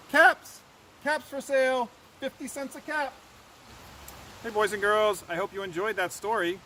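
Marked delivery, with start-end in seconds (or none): 0.1-3.1 high call, loud first word, then easing; street-seller's call. A narrator's reading. Peddler walking back to town, calling his wares. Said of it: "he walked back to town calling".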